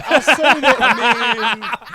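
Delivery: loud laughing mock hero. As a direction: laugh through a hammy recap.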